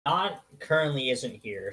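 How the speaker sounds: normal talking voice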